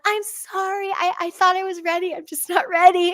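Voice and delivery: high-pitched, apologetic call with a laugh; pleading mock apology. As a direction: plead sorry in a high little voice. Acted apology to the creature through window.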